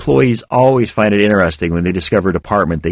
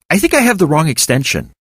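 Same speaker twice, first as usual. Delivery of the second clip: hint of exasperation; 8 semitones higher than usual. A narrator's reading. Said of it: "with a hint of exasperation in my voice".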